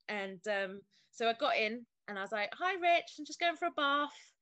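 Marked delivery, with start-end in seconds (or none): 2.5-4.3 high, loud breezy cheerfulness; fake casual. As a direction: sound chirpy to hide the panic. Hiding panic from husband.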